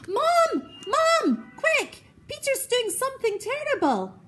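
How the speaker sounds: high, loud, tattletale cry for Mum; frantic call for Mum. A narrator's reading. Boy calling Mum to tell on his brother, loud and high. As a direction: yell for Mum like a tattling kid. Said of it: "said horrid Henry".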